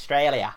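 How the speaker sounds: Australia said as "Ostraylia"; aussie pronunciation. Shown as a demonstration. He shows how locals say Australia. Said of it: "actually pronounced Australia".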